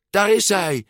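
high, excited call; loud call. A narrator's reading. Mother spots her son among the children, calling out high. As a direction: call out on spotting someone, pitched right up. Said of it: "riep ze met luide stem".

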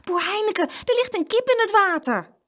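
high, urgent call; alarmed report. A narrator's reading. Boy telling farmer about bird in water, alarmed.

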